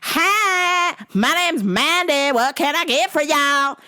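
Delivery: very high, drawling waitress; hammed-up perky server greeting; Mandy. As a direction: imitate a waitress in a stretched, high voice. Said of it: "Mandy comes over".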